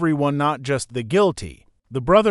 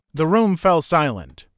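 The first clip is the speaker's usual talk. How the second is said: ordinary delivery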